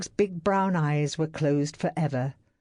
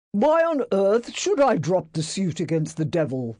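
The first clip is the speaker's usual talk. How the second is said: louder, angry snap; snapping; 4 semitones higher, 4 dB louder than usual. A narrator's reading. Employer snapping at his secretary. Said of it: "he snapped".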